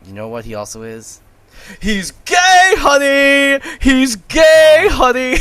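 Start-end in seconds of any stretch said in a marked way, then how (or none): 1.8-5.4 high, loud sassy shout. Pretend. Co-host teasing about Spicer, loud sassy shout. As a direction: belt it out like a camp diva. Campy mock exclamation, shouting.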